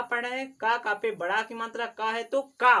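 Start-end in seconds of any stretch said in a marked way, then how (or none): 2.2-2.8 का said loud with a long full vowel; a strong, loud का; forceful long aa. Shown as a demonstration. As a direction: punch का out with power. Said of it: "उसको थोड़ा power से बोलोगे".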